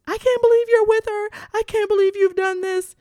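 high, loud, hysterical re-enacted outburst; hammed-up screechy confrontation; going off. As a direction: wail it at an ex across campus. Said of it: "just start going off".

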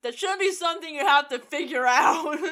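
loud, high-pitched exasperation; mad at the writers. Real, not acted. Host objecting to an excuse for the writers, exasperated. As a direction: protest it loudly, pitched well up, half laughing after. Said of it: "I'm mad at the writers".

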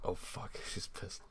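very soft, under-the-breath muttering; hushed dread. Acted. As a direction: say it barely audibly, hiding from her. Acts out Porky hiding under bed.

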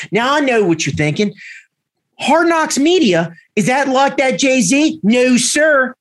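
a podcast intro in thick Baltimore; Balmer accent. Pretend. Cohost asked for the podcast intro in Baltimore accent. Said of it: "using your, your best Balmer [name] pronunciation".

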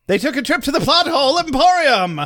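rising into a high, sung-out pitch; announcer-style buildup. Pretend. Podcaster making up a joke store for co-host, mock announcer. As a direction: build to a grand mock store-name reveal. Launching improvised mock ad bit.

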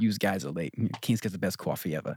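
thick mock Jersey accent; extreme Jersey accent. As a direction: imitate a heavy Jersey talker. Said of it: "people where they're that extreme".

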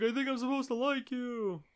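a hint of a bewildered TV amnesiac; dazed amnesiac. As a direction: imitate a confused man unsure of his feelings. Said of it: "He was like".

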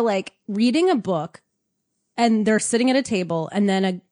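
ordinary delivery

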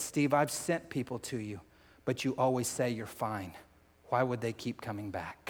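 a soft voice speaking to the heart; gentle inner voice. Retold or imitated. Holy Spirit speaking softly to Steve, a hint of tenderness. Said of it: "He said".

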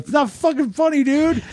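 high-pitched mock outrage; retold indignant protest. Retold protest at painful punches.

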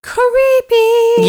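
high, eerie spooky voice. Acted. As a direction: wail it like a ghost. Spooky reaction to ghost story.

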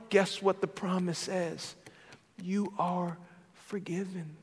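normal talking voice

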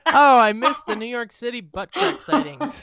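high, slow, laughing; laughing high mock nostalgia. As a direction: sigh it out fondly through laughter. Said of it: "I said to Bryn".

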